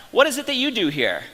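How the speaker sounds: put-on a light Massachusetts accent on "Sir". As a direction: address him politely in a hint of a Massachusetts accent. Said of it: "in his Massachusetts accent".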